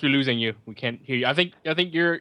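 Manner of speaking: ordinary talk, nothing marked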